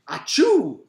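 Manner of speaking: loud, high acted sneeze; mock sneeze. A narrator's reading. Mister Gorf sneezes hard, a big fake sneeze. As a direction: explode into a spoken achoo. Acting out Gorf's huge sneeze.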